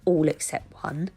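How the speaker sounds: ordinary delivery, nothing marked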